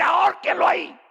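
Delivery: high, loud execution order; shouted royal sentence; angry command. Acted. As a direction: order the hanging. King orders hanging.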